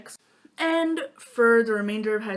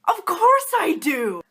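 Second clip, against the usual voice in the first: much louder, slow, higher mock-excited reply; mock eager; 9 semitones higher, 9 dB louder, slower than usual. She accepts the friend's apple, mock excited. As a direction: ham up the eagerness, slowly. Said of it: "So I said".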